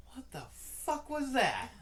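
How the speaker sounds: high, loud, drawn-out disbelief; baffled outburst. Retold or imitated. Customer reacts to the cashier's odd joke, baffled outburst. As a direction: stretch it out in comic bewilderment.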